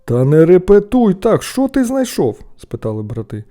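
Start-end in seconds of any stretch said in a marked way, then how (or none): 0.1-2.4 high, loud, irritated telling-off; exasperated, raised voice; annoyed questioning. A narrator's reading. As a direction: snap at your noisy brother. Annoyed brothers hush the boy.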